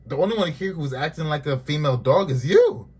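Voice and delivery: loud, high exasperated clapback; exasperated callout. Retold or imitated. Talking back to Malaysia on screen, loud and high. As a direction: call her out, outraged and loud. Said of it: "I'm just like".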